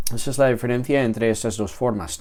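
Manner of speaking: usual speaking voice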